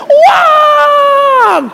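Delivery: high crying shout of warning; anguished no, yelled high; desperate shout. Retold or imitated. Inner voice warns before punch.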